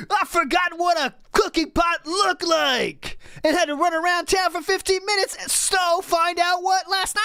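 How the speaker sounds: high, whiny imitation of an overwhelmed streamer; seething streamer. Reading out the streamer's seething message, mock whiny high voice.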